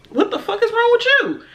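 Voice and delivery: high, half-laughing mock outrage; retold high-pitched disbelief; incredulous friend. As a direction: act out a friend appalled at someone's behavior.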